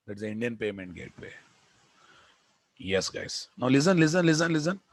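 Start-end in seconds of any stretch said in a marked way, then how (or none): 3.6-4.8 louder and higher, repeated call; calling for attention. Real, not acted. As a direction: grab the class's attention.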